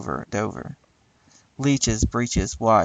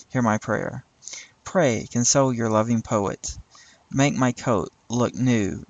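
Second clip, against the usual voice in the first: normal talking voice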